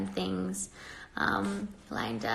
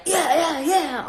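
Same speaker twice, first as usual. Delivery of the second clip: higher, bright chirpy yeahs, mocking; bubbly mock cheer; 8 semitones higher, 6 dB louder, slower than usual. Mocking how she'd need to sound for commenters, chirpy. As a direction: parody an always-perky girl. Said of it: "I'm just not like".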